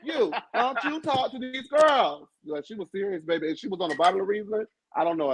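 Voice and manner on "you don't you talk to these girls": imitating Kim. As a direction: drag each word out like a stern threat. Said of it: "She took off that sweater and said".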